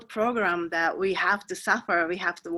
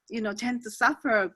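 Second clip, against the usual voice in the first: ordinary delivery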